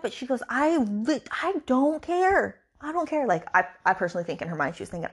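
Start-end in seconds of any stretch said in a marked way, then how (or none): 0.5-3.2 high-pitched, insistent dismissal; high mock-exasperated refusal; acted exasperation. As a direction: imitate someone emphatically fed up, voice raised in pitch. Acted emphatic refusal in retelling.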